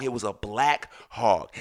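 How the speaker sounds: ordinary talk, nothing marked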